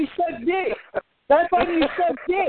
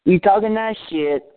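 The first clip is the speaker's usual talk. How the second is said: deep tough-guy voice; 9 semitones lower than usual. Pretend. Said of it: "You don't sound very threatening".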